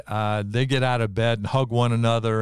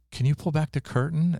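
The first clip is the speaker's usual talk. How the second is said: little voice; 8 semitones higher than usual. Retold or imitated. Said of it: "I hear a little voice".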